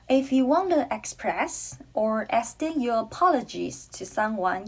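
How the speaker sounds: ordinary talk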